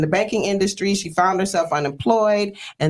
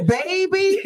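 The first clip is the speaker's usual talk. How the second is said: laughing; 14 semitones higher than usual. Real, not acted. Laughing before telling story.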